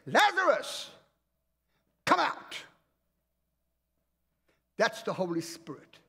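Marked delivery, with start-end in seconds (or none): 0.1-2.6 higher, loud commanding call; Jesus calling Lazarus. Retold or imitated. Jesus calls Lazarus from the grave, commanding call. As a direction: call him out of the grave with authority. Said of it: "what did Jesus say?".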